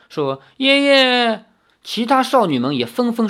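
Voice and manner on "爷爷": put-on high, loud tearful cry in a girl's voice. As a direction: burst into tears calling grandpa. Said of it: "顿时哭了出来". (哭喊爷爷)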